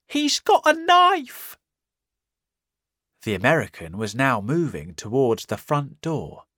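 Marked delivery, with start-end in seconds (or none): 0.1-1.6 very high, loud, slow panicked wail; terrified cry. Acted. Marvel warning the men in panic. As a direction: scream the warning.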